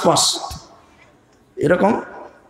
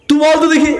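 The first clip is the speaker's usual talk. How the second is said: high, louder "tell me then"; raised taunting dare; challenging demand; 8 semitones higher, 3 dB louder, faster than usual. Retold or imitated. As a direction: press the boy hard. Repeats the challenge more forcefully.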